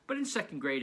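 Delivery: usual speaking voice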